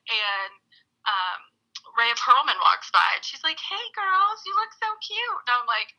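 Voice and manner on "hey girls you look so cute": put-on much higher, sugary hello to kids; imitating a gushing actress; sweet greeting. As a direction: coo at the little girls. Rhea Perlman voiced in story.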